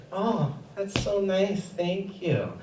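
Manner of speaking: quiet teacher reply; soft reply. Retold or imitated. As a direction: murmur a polite thanks. Teacher replies to child.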